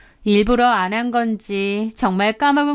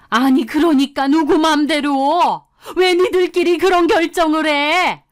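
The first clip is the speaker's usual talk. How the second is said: high, heated challenge; angry rising protest; indignant mother-in-law; 5 semitones higher, 4 dB louder than usual. A narrator's reading. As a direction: demand an answer from your son, outraged. Mother-in-law objects to couple's decision.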